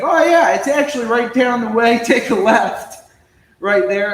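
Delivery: loud, high-pitched, helpful directions; suddenly animated quote; eager retold stranger.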